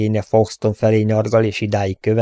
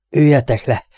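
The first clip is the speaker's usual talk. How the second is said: lihegve; 5 semitones higher, 4 dB louder than usual. A narrator's reading. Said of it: "léhegte".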